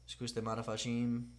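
soft mock-Italian; half-hearted Italian accent, kept quiet. Player repeating an old clue, soft Italian accent.

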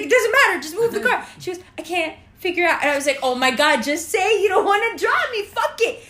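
loud, high exasperated yell at a friend; exasperated shout. Retold or imitated. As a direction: snap at her to just move the car, fed up.

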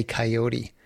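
coyote as kai-OH-tee; three-syllable coyote. Shown as a demonstration. Host explains the cartoon name, coyote as kai-OH-tee. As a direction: coyote with the last e sounded as "ee". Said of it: "when you say that".

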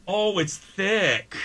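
high, slow grossed-out groan. Real, not acted. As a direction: drag it out, disgusted. Sauce poured onto bowl.

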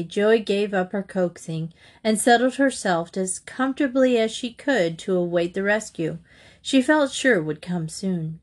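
usual speaking voice

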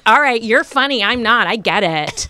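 put-on loud, huffy drunk retort rising to a squeal; hammed-up drunken indignation; offended drunk woman. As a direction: sulk loudly, then shriek the last claim. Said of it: "a very intoxicated woman was trying to tell".

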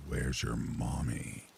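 low, slow, creepy caller; a slow menacing stranger's voice; low creepy man's voice. Retold or imitated. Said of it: "a very low and creepy man's voice".

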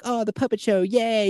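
put-on high, gushing kid voice at the restaurant. Cartman gushing over the puppet show, high and thrilled. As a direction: squeal over each attraction like a thrilled kid. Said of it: "He's like".

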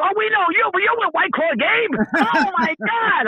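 high-pitched starstruck recognition; excited fans. Retold or imitated. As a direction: imitate fans gushing on spotting a celebrity. Voicing fans who recognize them.

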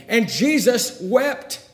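high, slow, drawn-out proclamation; forceful verse climax. A narrator's reading. Preacher retelling the grave scene, forceful climax. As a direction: proclaim it with full pulpit force.

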